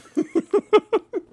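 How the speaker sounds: high-pitched real giggle. Real, not acted. Host joking about skipping Thanksgiving, giggling.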